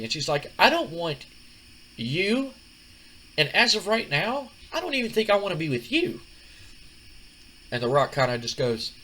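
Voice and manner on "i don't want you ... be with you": much higher, cold rejecting woman's voice. Retold or imitated. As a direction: dump him outright. [name] breaks up with [name].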